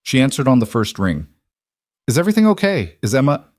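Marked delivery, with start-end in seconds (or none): 2.1-3.4 anxious high voice; worried mother, pitch up. A narrator's reading. Worried mother answers phone.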